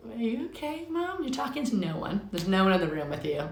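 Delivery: a high, puzzled dog voice; voicing the dog. Her confused dog asking Mom if she's okay, high puzzled voice. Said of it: "my dog is so confused looking at me like".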